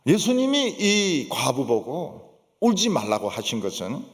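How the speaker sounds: ordinary delivery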